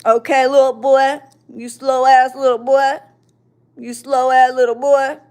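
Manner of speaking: loud, taunting mock thug voice; put-on tough taunt; mocking thug talk. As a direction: throw their thug talk back at them. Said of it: "that's not me talking thug to them".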